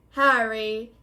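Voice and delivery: loud, drawn-out mock formality; mock-pompous greeting. A narrator's reading. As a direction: hail him with exaggerated grandeur. Fred mocks pompous Percy's greeting.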